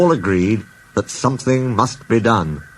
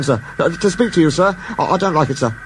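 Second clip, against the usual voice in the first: nervous stammer; 8 semitones higher, faster than usual. A narrator's reading. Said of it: "You look nervous".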